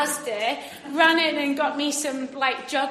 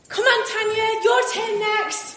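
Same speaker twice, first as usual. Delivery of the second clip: high, loud call to Tanya across the gap; shouting encouragement over a ravine; 8 semitones higher, 5 dB louder than usual. Retold or imitated. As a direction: call her over from the far side. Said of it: "I was like".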